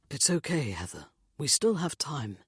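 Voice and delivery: gentle reassurance; reassuring. A narrator's reading. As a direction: soothe the panicking mother. Nick calms Heather.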